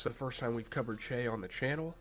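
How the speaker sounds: ordinary talk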